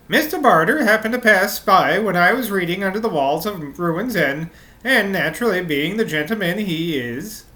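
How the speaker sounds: sing-song Norwegian lilt, pitch bobbing up and down; Norwegian accent. Acted. Maid explains meeting the gentleman, Norwegian accent. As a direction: commit fully to a bouncy Norwegian accent. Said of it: "I'm just gonna go with it".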